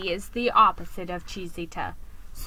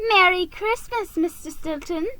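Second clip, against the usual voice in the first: high little squeaked greeting; 9 semitones higher than usual. A narrator's reading. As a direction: squeak it out nervously.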